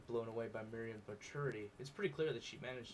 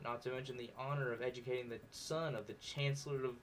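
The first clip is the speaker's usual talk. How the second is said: ordinary talk, nothing marked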